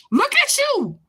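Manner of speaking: high disbelieving look at you; incredulous. Real, not acted. Taunting the commenter, high and incredulous. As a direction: spike it up in disbelief.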